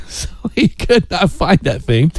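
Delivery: real laugh breaking into the words; amused laughing. Real, not acted. Radio host amused by the fruitless ring search, laughing.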